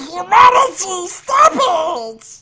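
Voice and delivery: high, very loud melodramatic cry; host screaming Humanity, stop it! at the chaos; mock anguished scream. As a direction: scream it to the heavens like a B-movie hero. Over-the-top outcry at the chaos.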